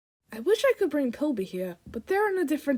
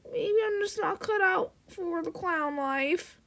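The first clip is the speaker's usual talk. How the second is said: homesick clown; 6 semitones higher than usual. Acted. Clown missing mama and best buddy, high and wistful. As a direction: sigh through it like a forlorn clown. Voices a sad young clown character.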